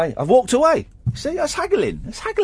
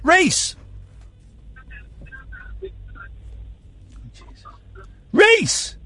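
calling out; 4 dB quieter than usual. Real, not acted. Calling out to caller.

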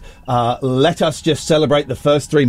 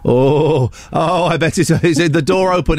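ordinary talk, nothing marked

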